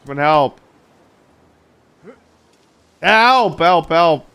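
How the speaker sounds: loud desperate yells for help; screaming for help. Real, not acted. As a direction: shout for help, panicking. Still hanging, calling for help.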